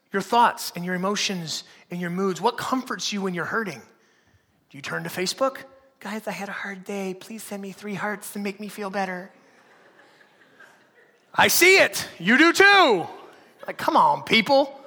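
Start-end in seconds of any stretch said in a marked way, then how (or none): 6.0-9.3 mock pitiful plea. Mocks self-pitying Facebook post.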